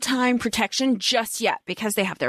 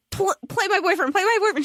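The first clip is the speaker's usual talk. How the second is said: pleading; 8 semitones higher, faster than usual. Retold or imitated. Retold Hermione pleading.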